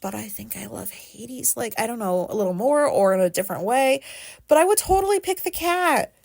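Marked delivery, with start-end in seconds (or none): 0.0-1.6 a soft, guilty whisper; whispered confession. Real, not acted. Admitting to listeners she loves the cat more, whispering. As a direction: breathe the admission out like a secret. Guilty admission under breath.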